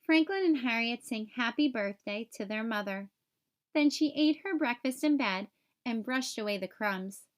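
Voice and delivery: plain reading voice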